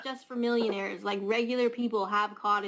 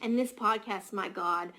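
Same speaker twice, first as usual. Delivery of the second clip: normal talking voice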